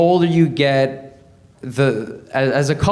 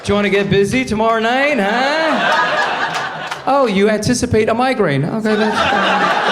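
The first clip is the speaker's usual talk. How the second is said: higher, sly and playful; scheming husband voice; 5 semitones higher than usual. Acted. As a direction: act out the cunning king's moves. Husband role-plays sly moves.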